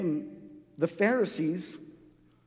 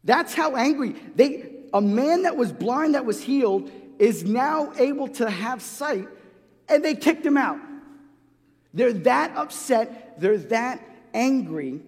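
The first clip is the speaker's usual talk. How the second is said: louder, higher impassioned preaching; preaching with fire; 5 semitones higher, 4 dB louder than usual. Acted. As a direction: build the outrage for the congregation. Preaching rises to full force.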